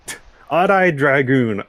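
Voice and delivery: usual speaking voice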